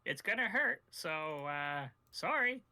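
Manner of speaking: NPC voice. Acted. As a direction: cheerfully warn them it will hurt.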